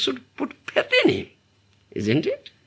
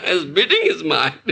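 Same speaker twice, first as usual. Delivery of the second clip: laughing; 4 semitones lower than usual. Real, not acted. Laughing at a listener's answer.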